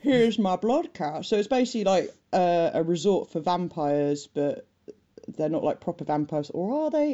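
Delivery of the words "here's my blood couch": put-on slow, drawn-out posh seventies Australian accent. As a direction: lightly send up a plummy Australian host. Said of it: "posh Australian accents".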